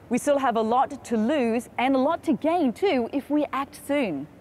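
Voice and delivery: ordinary talk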